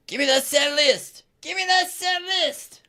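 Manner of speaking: high-pitched heckler yell, mimicked; imitated drunk yelling. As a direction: holler it like a hammered fan pestering the singer. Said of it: "he's yelling at [name]".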